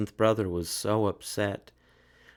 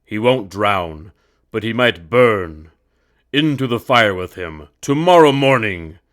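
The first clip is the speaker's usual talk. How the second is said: decree a death sentence; commanding emperor; 10 dB louder than usual. A narrator's reading. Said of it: "he commanded".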